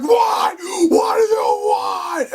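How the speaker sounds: high, loud irritated shout; hammed-up angry villain; exasperated yell. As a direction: snap back at the interruption. Villain snapping at driver.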